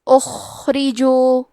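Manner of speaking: اخرجو with a heavy throat خ and a weak, sunken hamza, drawn out slowly; Quranic recitation. Shown as a demonstration. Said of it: "تو ہم یہ کلمہ کو ایسے پڑھیں گے".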